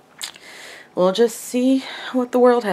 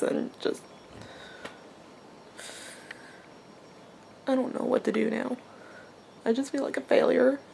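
whispery tearful fade; snotty crying; 4 semitones higher than usual. Real, not acted. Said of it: "I'm even crying on camera".

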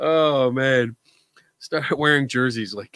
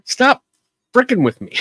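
mock annoyed; 9 semitones higher, 5 dB louder than usual.

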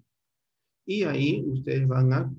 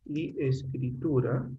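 slow dictation; much slower than usual. Shown as a demonstration. Teacher dictating title to class.

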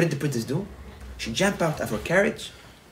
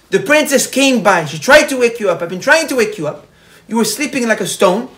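raised voice, pressing the news on him; louder and higher, driving it home; urgent loud telling; 7 semitones higher, 11 dB louder than usual. Acted. Guard urges his master who slept through everything, raised and urgent.

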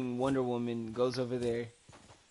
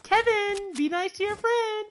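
high mock mom scolding. Friend's mom calling over to Kevin, high mock mom voice. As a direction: play his nagging mom, pitched way up. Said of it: "She's like".

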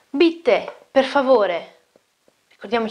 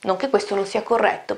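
usual speaking voice

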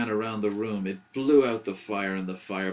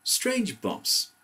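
plain reading voice

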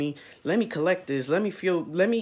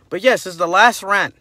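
loud hype; 4 semitones higher, 12 dB louder than usual. Real, not acted. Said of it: "hyped announcement".

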